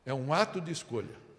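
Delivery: usual speaking voice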